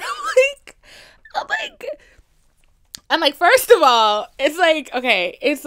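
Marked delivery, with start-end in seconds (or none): 0.0-1.9 laughing. Real, not acted. Laughing fit mid-story.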